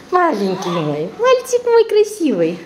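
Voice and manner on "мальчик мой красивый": very high squeaky cooing. Real, not acted. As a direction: squeal praise at the pet. Cooing over her pet.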